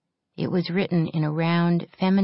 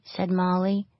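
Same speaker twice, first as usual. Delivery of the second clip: plain reading voice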